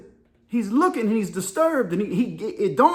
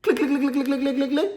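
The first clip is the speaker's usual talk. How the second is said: high, rapid-fire clicking noise; imitated gun clicks; 6 semitones higher than usual. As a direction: rattle off clicks like a pistol. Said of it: "Leon looks at him and goes".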